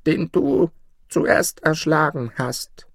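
put-on high, slow old woman's voice; old forest woman. A narrator's reading. Bush mother shows boy her dead son, high slow accusation. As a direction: draw it out high as the crone. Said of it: "sprach sie".

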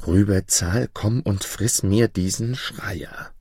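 plain reading voice

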